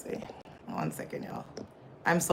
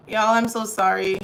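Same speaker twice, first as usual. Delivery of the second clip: louder, higher, sheepish sorry; loud apology; 5 semitones higher, 8 dB louder than usual. Real, not acted. Streamer apologizing to live chat, loud and sheepish. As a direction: blurt the apology out to the viewers.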